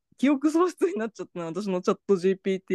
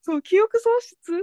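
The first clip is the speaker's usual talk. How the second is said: mock surprise; 12 semitones higher, 3 dB louder, slower than usual. Shocked at the chatbot's changed replies.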